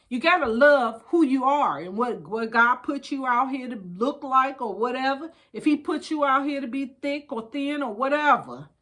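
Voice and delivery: ordinary delivery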